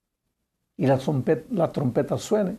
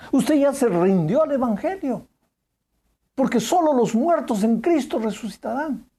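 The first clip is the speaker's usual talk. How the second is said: urgent preaching appeal; 7 semitones higher than usual. Acted. Preacher presses the question home.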